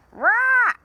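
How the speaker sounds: high loud rah squawk; loud parrot squawk. Acted. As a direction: screech like a parrot. Parrot's noise cry.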